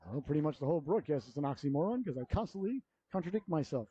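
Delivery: ordinary talk, nothing marked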